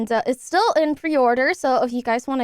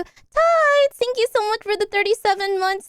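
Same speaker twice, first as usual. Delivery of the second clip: excited thanks; 8 semitones higher than usual. Real, not acted. Excited thanks to long subscriber.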